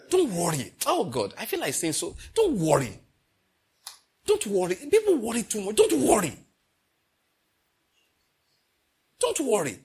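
rising, insistent preacher's reassurance; preaching. Real, not acted. Preacher urging the congregation not to worry, rising. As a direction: press it home to the congregation.

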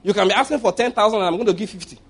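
ordinary delivery

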